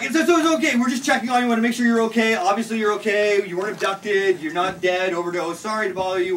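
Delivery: high, loud, hurried placating; flustered reassurance. Retold or imitated. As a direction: re-enact backing out apologetically in a fluster. Flustered responders reassuring startled woman.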